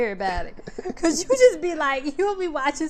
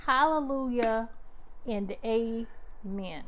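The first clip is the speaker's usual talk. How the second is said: slow, fading amen; testify, slowly; slow church amen; 6 dB quieter, much slower than usual. Real, not acted. Agreeing like in church.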